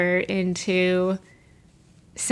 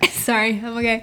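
recovering from choking, still strained; swallowed wrong way. Real, not acted. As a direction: talk just after water went down wrong. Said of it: "I just swallowed it the wrong way".

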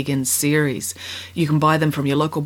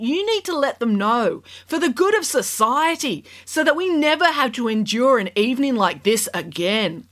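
sarcastic scolding tv judge; 8 semitones higher than usual. A narrator's reading.

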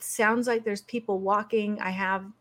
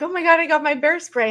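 higher, louder and fast; alarmed outburst; 7 semitones higher, 6 dB louder, faster than usual. Retold or imitated. Grabbing bear spray as the dog bursts in, panicked outburst. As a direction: blurt it out in a panic. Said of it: "I'm like".

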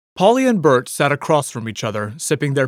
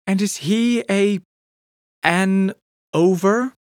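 higher Polly voice, stumbling on a word; Polly correcting herself; 8 semitones higher, slower than usual. A narrator's reading. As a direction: catch the slip and fix it. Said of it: "Polly corrected herself quickly".

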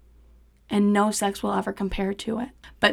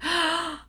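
acted high gasp; shocked gasp; 9 semitones higher, 4 dB quieter than usual. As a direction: suck in air in mock shock. Her reaction to the threat.

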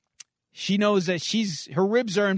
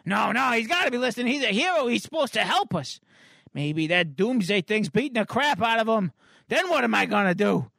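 put-on raised, fretful kid voice; Mitch; 4 semitones higher than usual. Boy insists the hero must be listening, fretful and raised. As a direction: argue like an upset boy. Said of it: "he's got tears welling up in his eyes".

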